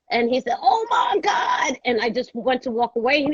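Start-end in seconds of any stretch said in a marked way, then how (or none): 0.6-1.8 high, drawn-out cry of disbelief; hammed-up high-pitched exclamation; mock astonishment. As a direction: imitate someone wailing in amazement, stretching each word. Imitates his astonished reaction in story.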